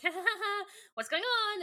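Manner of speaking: high, hyperventilating panic; pant, then squeal in pretend panic; mock panicking. Aldrea panicking in Cassie's body, high mock hysteria.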